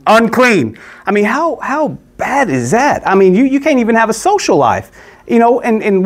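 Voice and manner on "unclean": a raised call of unclean; calling out a warning. Retold or imitated. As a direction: call it out to warn people off. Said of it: "and announce".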